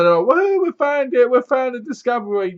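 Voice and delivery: high, loud whooping triumph; whooping scientist over the find; excited cheer. Retold or imitated. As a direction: cheer like you just struck gold. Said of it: "So they grab her and say".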